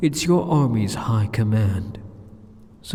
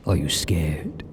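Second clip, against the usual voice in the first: a lower, darker teasing question; low taunt; 8 semitones lower, slower than usual. Acted. Demon lord taunting the startled hero, low and dark. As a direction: drop the voice to unsettle him.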